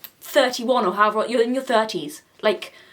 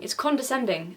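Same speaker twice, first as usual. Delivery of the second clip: usual speaking voice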